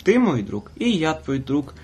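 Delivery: usual speaking voice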